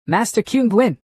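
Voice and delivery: high-pitched, restrained cry of alarm; panicked cry. A narrator's reading. Family head calling to the struck monk, mildly panicked. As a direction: call the name out high, with mild panic. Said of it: "Tran Tien Hung cried out in panic".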